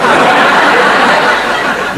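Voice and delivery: loud breathy nervous laugh; forced laugh. Acted. Teacher laughing off a heart scare, loud and forced. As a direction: laugh it off too loudly. Covering up a funny turn.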